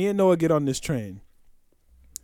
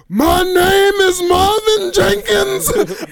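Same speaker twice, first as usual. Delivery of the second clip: slow, much higher and louder anguished cry; crying wail; 12 semitones higher, 5 dB louder, much slower than usual. Retold or imitated. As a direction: wail the name out like breaking down. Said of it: "He said".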